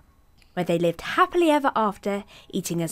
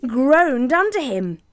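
louder, slow groan; groaning bridge; 6 semitones higher, 6 dB louder, slower than usual. A narrator's reading. Narrator making the bridge groan under the big goat, loud and slow. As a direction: stretch the word out heavy.

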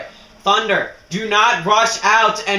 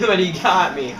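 usual speaking voice

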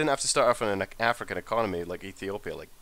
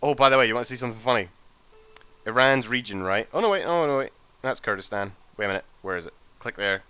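usual speaking voice

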